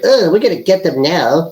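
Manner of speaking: lightly voiced smug activist; a hint of a gloating media watchdog; Media Matters person. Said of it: "everyone from Media Matters sounds that way".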